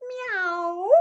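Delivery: high, soft kitten mew; small, high cat cry; meowing tiger. Acted. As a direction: meow like a tiger without its roar. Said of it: "The tiger meowed again".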